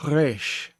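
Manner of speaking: resh with a hint of a back-of-throat R; lighter throaty resh. Shown as a demonstration. Teacher giving a gentler throaty resh. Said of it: "like I was doing it then".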